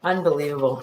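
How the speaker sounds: ordinary delivery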